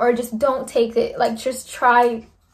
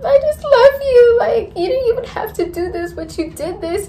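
much higher, louder, voice breaking into tears; crying gratitude; 12 semitones higher, 4 dB louder than usual. Retold or imitated. Thanking her friend for helping after surgery, crying. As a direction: sob it out, overwhelmed with thanks. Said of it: "So I just kept crying".

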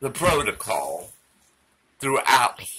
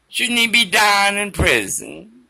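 ordinary delivery

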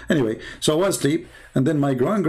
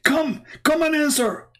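taunting challenger; 9 semitones higher than usual. Retold or imitated. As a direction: dare him to come forward, pitched way up. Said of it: "He said".

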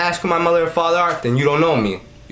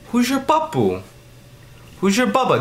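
high mock voice; 10 semitones higher than usual. Playing askers of the question.

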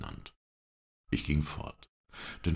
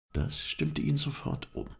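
plain reading voice

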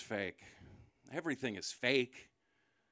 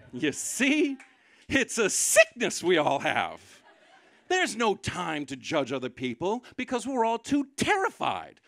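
impassioned rant; 6 semitones higher, 6 dB louder, slower than usual. Real, not acted. Rising preachy rant.

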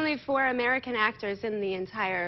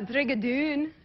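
Scottish accent; slower than usual. Shown as a demonstration. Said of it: "Scottish accent right there".

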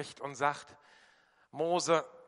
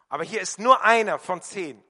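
higher and much louder; loud emphasis; 4 semitones higher, 11 dB louder than usual. Real, not acted. Preacher stressing to the congregation that only one of ten came back, loud emphasis. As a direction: drive the point home. Preacher drives point home.

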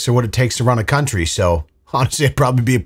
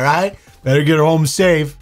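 lightly mocked big-brother warning, pitched up; alpha older brother; 5 semitones higher than usual. Mocking a brother's warning to get her home, mock-tough. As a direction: warn like a mock tough guy. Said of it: "alpha older brother Facebook memes".

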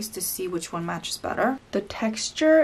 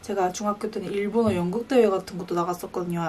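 ordinary talk, nothing marked

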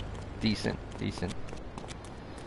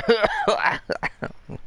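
real smoker-like coughing fit; 11 semitones higher than usual. Real, not acted. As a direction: cough hard in a burst. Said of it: "Why am I coughing".

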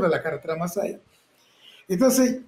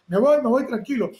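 nervous; 6 semitones higher than usual. Retold or imitated. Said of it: "guardé la camioneta".

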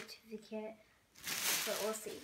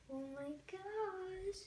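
high, drawn-out oh my gosh; adoring coo; 8 semitones higher, much slower than usual. Real, not acted. Vlogger admiring the doll's painted hair, adoring. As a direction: stretch it out in high-pitched awe.

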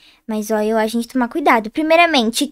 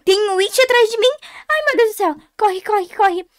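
aguda, assustada, suplicando; pânico em fuga; 7 semitones higher than usual. Real, not acted. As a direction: fuja gritando de medo, voz aguda. Foge do It atrás dela. (desespero de quem é perseguida)

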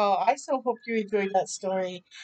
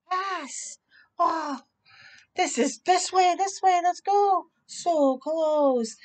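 higher, urgent game calls; excited players calling; 6 semitones higher, much slower than usual. Acted. Soccer players call to teammates mid-game, excited. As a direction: shout for the ball.

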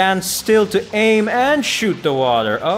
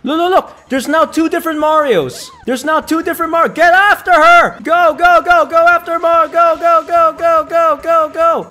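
excited yelling; 13 semitones higher, 8 dB louder than usual. Real, not acted. Yelling at game chase.

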